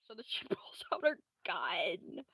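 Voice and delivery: slow, hushed whisper. Acted. Telling the friend Clara draws a gun, whispered. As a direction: creep the words out slowly. Narrating the sneaky ambush.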